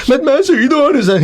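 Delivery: hammed-up protesting student. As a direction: whine at the master in mock exasperation. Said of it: "a crazy question like".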